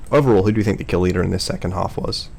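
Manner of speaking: ordinary talk, nothing marked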